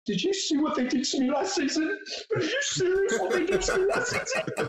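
high, mocking whiny voice; mock Kyle whine. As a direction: mimic his whining complaint, pitched high. Mocking Kyle's whiny complaint.

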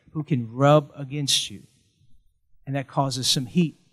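normal talking voice

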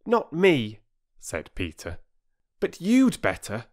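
high schoolboy bravado; lightly voiced boy, refusing; brave boy. A narrator's reading. Peter refusing to run from the bargee, high bravado. Boy's voice.

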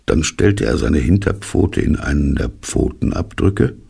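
ordinary delivery, nothing marked

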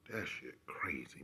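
whispered aside. Real, not acted. Reacting to the old photo, whispered. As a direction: breathe it out in a whisper.

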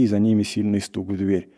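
ordinary delivery, nothing marked

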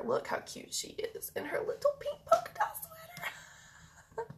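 high cooing whisper. Real, not acted. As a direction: breathe it out adoringly. Cooing over dog's sweater.

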